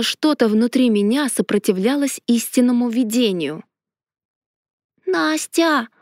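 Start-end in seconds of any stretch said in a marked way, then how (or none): 5.1-5.9 put-on high childlike call; high little voice. A narrator's reading. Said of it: "высокий голосок Леонари".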